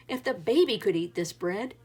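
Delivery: usual speaking voice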